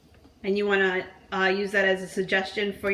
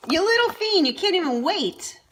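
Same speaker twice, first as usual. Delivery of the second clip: high teasing scold; quicker repeat of the tease; playful scolding; 8 semitones higher than usual. Acted.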